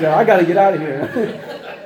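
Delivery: slow, laughing mock panic; half-laughing, play up the urge to flee; laughing panic thought. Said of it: "My first thing was".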